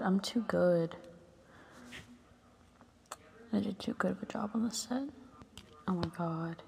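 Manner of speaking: whispered to herself. Real, not acted. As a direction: whisper it under your breath. Muttering to herself after nail breaks.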